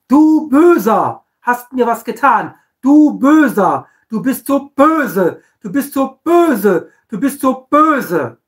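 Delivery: hammed-up du bist so böse, drawn out; mock accusing whine. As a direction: whine like a hurt child pointing fingers. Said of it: "nicht richten und dann auch noch verdammen".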